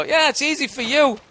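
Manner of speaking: sarcastic imitation of jealous comics; mocking other comics. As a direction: parody the complaint as a grumbling rival. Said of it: "they just go".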